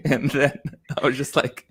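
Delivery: usual speaking voice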